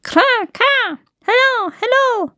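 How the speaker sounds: high squawking crow voice; cawing crow. Acted. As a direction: caw it out like a bird. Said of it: "mit dem Kopf wackelte und sagte".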